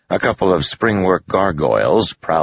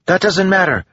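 high, louder cut-in; quick interruption; 5 dB louder than usual. A narrator's reading. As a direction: jump in over someone, pitched high. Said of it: "Melody said quickly, cutting Joel off".

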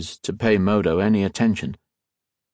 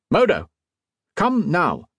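shouted; 10 semitones higher than usual. A narrator's reading. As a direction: shout across to hurry the boy. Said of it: "Mister Socrates shouted".

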